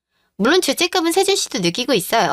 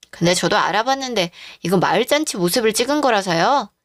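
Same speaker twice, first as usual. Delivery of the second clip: ordinary talk, nothing marked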